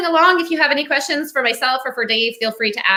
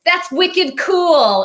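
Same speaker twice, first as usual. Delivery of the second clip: put-on slowed, higher Boston accent on wicked cool; 7 semitones higher, 3 dB louder, much slower than usual. Host retells talking to her Southern roommate, Boston accent. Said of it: "couldn't communicate with each other 'cause".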